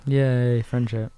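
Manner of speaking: deadpan mock celebration; unenthusiastic cheer. Producer echoing host's friendship moment, unenthusiastic cheer. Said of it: "That sounded very enthusiastic".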